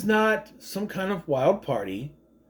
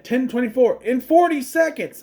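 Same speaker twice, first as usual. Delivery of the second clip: mock girl's line with a higher, louder pitch; misheard quote; 8 semitones higher, 4 dB louder than usual. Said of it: "I always thought she said".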